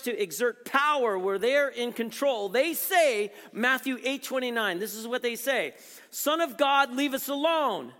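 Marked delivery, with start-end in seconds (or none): 6.1-7.9 demon crying out. Retold or imitated. Demons cry out at Jesus.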